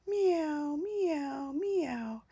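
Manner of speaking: sighing kittens. A narrator's reading. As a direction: mew with a sad little sigh. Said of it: "And then they began to sigh".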